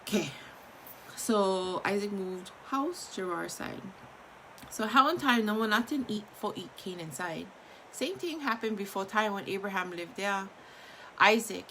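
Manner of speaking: usual speaking voice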